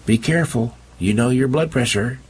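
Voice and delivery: wife nagging husband about his health; fussing wife. Acted. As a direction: fuss over him a little. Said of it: "Gwen said".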